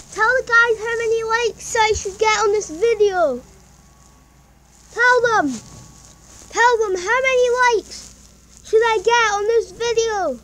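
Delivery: high, loud coaxing of the cat; coaxing pet talk. Real, not acted. Kid loudly coaxing the cat to say the likes. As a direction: coax the cat to answer you.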